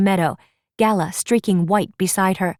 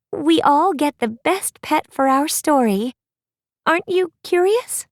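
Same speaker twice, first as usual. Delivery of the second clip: higher, eager princess coaxing her friend; Apple's bright princess voice; 7 semitones higher than usual. Acted. Apple urging Raven to pull the popper, eager princess voice. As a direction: sound hopeful and curious, a little sing-song.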